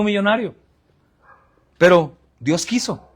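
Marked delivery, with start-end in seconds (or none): none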